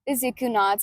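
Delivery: usual speaking voice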